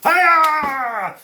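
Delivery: very high, loud, drawn-out "hi-ya"; mock attack cry. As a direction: shriek it like a sword-swinging attack. Demonstrates waking with sword swing.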